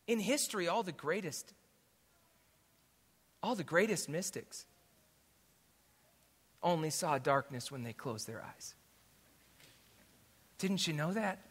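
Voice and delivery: very soft, gentle retold counsel; quiet reassurance. Friend quietly tells father about the mystics, quiet reassurance. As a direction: hush it right down, like sharing a secret.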